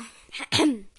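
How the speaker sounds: loud throat clear; loud "ahem". Real, not acted. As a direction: clear the throat before reading on. Clears throat before resuming reading.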